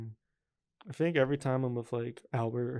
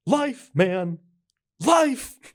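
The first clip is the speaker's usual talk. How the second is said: shouted louder and higher, the second Life even higher; Bob's exasperated yell; 10 semitones higher, 12 dB louder, much slower than usual. Retold or imitated. Friends quoting Bob's line, an exasperated shout. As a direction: yell it out like a fed-up man giving up on life. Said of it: "funny just the way he says it".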